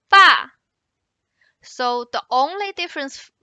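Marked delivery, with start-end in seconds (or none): 0.1-0.5 "ba" falling steeply from high; short, loud falling "ba"; fourth tone. Shown as a demonstration. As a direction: drop "ba" hard from top to bottom. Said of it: "Let's try again".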